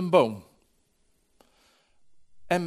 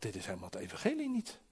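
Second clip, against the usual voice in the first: softly thinking it to himself, quicker; inward thought; 9 dB quieter, faster than usual. Retold or imitated. Preacher recalls silently doubting the man preaching, said softly as a thought. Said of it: "En ik dacht".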